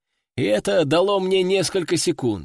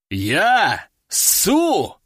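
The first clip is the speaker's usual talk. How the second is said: outraged disbelief; 2 semitones higher than usual. Acted. Commander answers a dare to his courage, outraged disbelief. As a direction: spit back two affronted questions.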